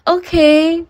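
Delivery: put-on a proper young lady taken aback; prim lady voice. Lottie's reaction voiced in story.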